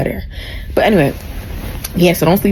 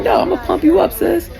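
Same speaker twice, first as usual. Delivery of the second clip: much higher, cheerful hype woman; hyping up; 12 semitones higher than usual. Retold or imitated. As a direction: gush encouragement up high. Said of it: "She's like".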